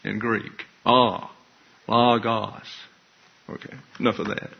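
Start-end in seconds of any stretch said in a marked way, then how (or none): 0.8-2.7 "Ah", then "logos" as LAH-gos; short-O pronunciation. Shown as a demonstration. Teacher models Greek "logos" with short ah. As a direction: sound "Ah" alone, then carry it into "logos". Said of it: "for a short O is ah".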